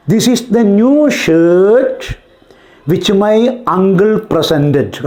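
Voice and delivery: slow, word by word example sentence; slow dictation. Shown as a demonstration. Teacher dictating an English example sentence to students, slowly. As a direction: spell out each word slowly for the class.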